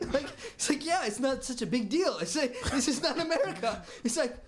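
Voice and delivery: high, laughing mock of a casual friend. Retelling to the host how the friend shrugged it off, laughing. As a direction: giggle through his shrugging words.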